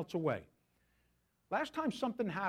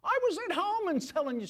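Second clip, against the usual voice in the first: whiny Jonah; 11 semitones higher, 4 dB louder than usual. Retold or imitated. Paraphrasing Jonah's complaint.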